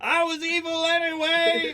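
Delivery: high, strained cartoon voice; dying villain elf. Crushed elf's last words, high hammed-up cry. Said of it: "and he goes".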